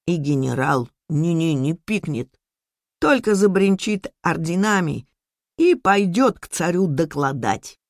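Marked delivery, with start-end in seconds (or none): none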